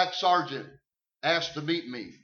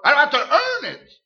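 higher and louder, preacher's cry; full-force preaching; 8 semitones higher, 11 dB louder than usual. Acted. Preacher proclaims faith to the congregation, fiery and loud. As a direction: preach it with rising fire.

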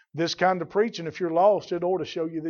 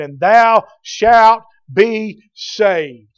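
"and thou shalt be saved" very loud and drawn out; emphatic statement; 3 semitones higher, 11 dB louder, much slower than usual. Acted. Preacher quoting the promise to the jailer, emphatic and slow. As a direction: hammer "thou shalt be saved" slowly, word by word. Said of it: "look at what an emphatic statement".

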